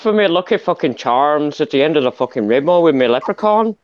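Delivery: sweary stage-Irish brogue; Irish accent. Shown as a demonstration. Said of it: "Irish should talk like this".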